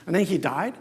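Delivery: usual speaking voice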